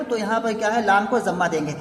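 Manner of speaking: ordinary talk, nothing marked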